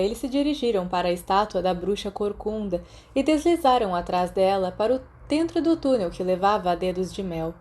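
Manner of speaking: usual speaking voice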